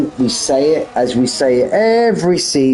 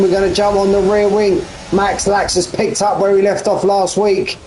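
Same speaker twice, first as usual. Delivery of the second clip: high-pitched, sustained play-by-play; excited motorsport commentator; live race commentary; 8 semitones higher than usual. Real, not acted. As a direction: call the race start with lifted energy.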